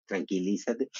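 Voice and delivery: husband calming his rushing wife, softly. Retold or imitated. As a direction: hush her down like a patient spouse. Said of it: "me tranquiliza, Marco Antonio".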